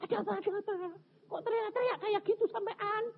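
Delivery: high, hushed alarmed voice; bewildered husband. Retold or imitated. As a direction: imitate a startled husband asking. Menirukan suami kaget bertanya. (suara suami kebingungan, tinggi)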